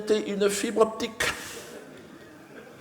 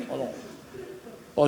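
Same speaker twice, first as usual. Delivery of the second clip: usual speaking voice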